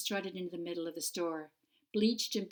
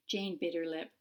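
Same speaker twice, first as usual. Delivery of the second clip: ordinary delivery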